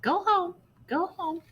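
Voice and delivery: very high singsong parrot; high parrot chants "Go home". Acted. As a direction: sing it like a parrot. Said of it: "the parrot sang".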